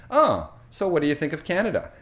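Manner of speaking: quick casual question, barely slowed; fast chatty question. Retold or imitated. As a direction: imitate a relative asking just as quickly. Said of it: "so fast for this person".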